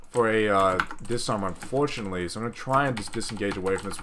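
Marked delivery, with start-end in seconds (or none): none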